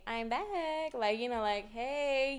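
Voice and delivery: lightly put-on breezy hello; feigned casual. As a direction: act like nothing's wrong. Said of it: "trying to, like, not show that I'm scared".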